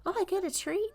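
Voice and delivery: high squeaky puppy voice; cute dog getting a treat, high-pitched. Pretend. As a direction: voice the happy puppy. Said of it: "It's like".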